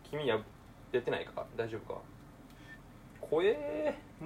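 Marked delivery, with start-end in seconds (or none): 3.2-4.2 a long, high, slow "怖えー"; scared. Real, not acted. Frying an egg and scared of breaking the yolk, a drawn-out cry. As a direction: stretch the fear out over the hot pan.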